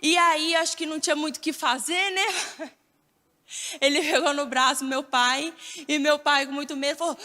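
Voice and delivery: ordinary talk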